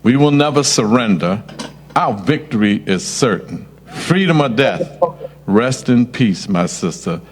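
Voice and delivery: low, measured memorial read; formal memorial statement. Acted. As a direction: read a solemn tribute to a fallen sister.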